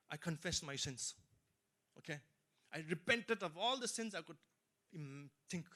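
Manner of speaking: normal talking voice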